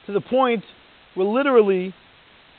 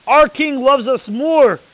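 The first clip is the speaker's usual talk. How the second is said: each word slow, loud and higher; slow emphatic stress; 5 semitones higher, 8 dB louder, much slower than usual. Real, not acted. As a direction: lean hard into Our king loves us more. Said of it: "preacher stresses the point".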